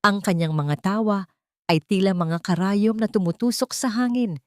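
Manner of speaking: usual speaking voice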